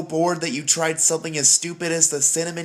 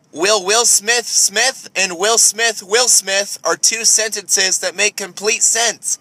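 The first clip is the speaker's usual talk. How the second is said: ordinary delivery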